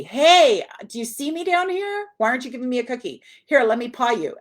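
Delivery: high, loud dog demanding a cookie; impatient pet talking up to its owner; pushy dog voice. Retold or imitated. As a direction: play the dog nagging for a treat. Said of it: "they're like".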